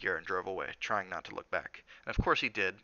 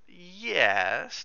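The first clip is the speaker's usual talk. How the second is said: drawn-out, high, doubtful yes; a little dubious; 9 semitones higher, 7 dB louder than usual. A narrator's reading. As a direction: stretch it out, louder and unsure. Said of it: "a little dubious".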